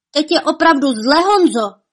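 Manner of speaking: high, loud cry of warning; alarmed shout. A narrator's reading. Sharp-eyed Kuba warns Honza of danger, alarmed shout. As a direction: shout a warning to your friend. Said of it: "vykřikl bystrooký Kuba Hnipírek".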